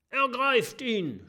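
the captain yells for his men to seize him; shouting. A narrator's reading. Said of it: "schreit der Piratenchef".

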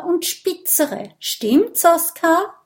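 squeaky high cheeky appeal; high boasting question. A narrator's reading. Kokosnuss asks Oskar to back up his claim, high. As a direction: fish for agreement.

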